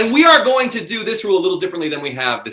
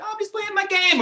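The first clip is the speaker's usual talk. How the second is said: much higher, squeaky imitation of a distracted viewer; mock audience member; 4 dB quieter than usual. Host mocking a multi-screening viewer, high squeaky voice. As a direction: mimic someone glued to their phone. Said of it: "you're like".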